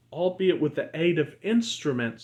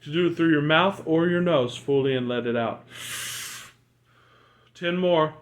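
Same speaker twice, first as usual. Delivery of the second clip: guided breathing coach. Acted. Leads breathing exercise.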